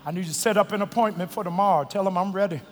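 low soft appeal; quiet. Acted. Softer closing appeal.

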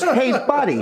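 high-pitched put-on voice; high mock-confrontational greeting; bar worker's voice. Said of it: "he goes, in this voice".

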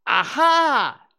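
high, loud, drawn-out exclamation; Piglet's anxious "aha". A narrator's reading. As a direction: call it out hoping others will hear. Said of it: "looking around anxiously for the others".

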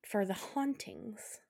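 whispered, slow and eerie; spooky whisper. Acted. Building suspense for the listener, eerie whisper. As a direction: breathe it like a ghost story.

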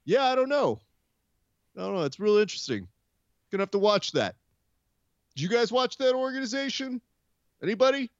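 high, mocking Dana voice, dodging the question; evasive Dana. Mimicking Dana fielding a question about Khabib's organization, high and evasive. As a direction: ham up Dana squirming past an awkward question. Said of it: "there's your answer".

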